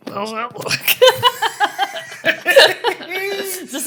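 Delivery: laughing hard, squeaking up high through the chins; cracking up while mugging for the camera. Real, not acted. Making triple chins on camera.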